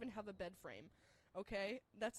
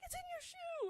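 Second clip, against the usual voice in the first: frantic cry. Retold or imitated. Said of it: "and she's like".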